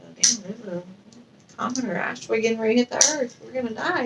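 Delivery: quiet, fast doom mutter; mock dread, hushed. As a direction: murmur it like the end is near. Imagined reaction to asteroid news.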